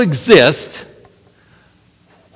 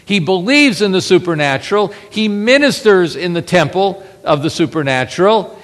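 ordinary talk, nothing marked

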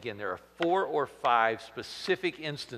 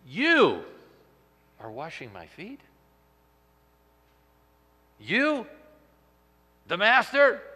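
stunned disciple questioning his master, stressing "You"; flabbergasted; 7 semitones higher, 6 dB louder than usual. Acted. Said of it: "flabbergasted by this".

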